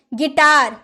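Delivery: गिटार with plain g; silent u. Shown as a demonstration. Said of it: "कभी इसे आपने गुटार बोला है?". (गिटार, यू मौन)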